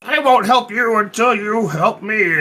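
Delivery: lightly voiced, slow mock townsman; a hint of a sulky bargainer; retold guy setting his terms; imitating the townsman. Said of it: "And he was like".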